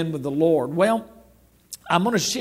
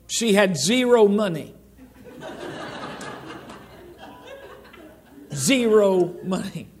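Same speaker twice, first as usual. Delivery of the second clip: chuckling through the punchline; laughing; 3 semitones higher than usual. Real, not acted. Preacher joking to the congregation, laughing. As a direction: laugh as you stress zero. Joking about daughter's account.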